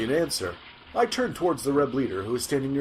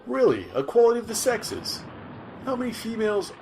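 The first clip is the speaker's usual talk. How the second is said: incredulous; 9 semitones higher than usual. Acted. Reporter challenges the leader's claim.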